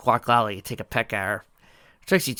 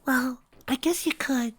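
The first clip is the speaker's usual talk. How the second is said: shy whisper; slower than usual. A narrator's reading. Fluttershy shyly offering the task, in a whisper. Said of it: "Rather shyly".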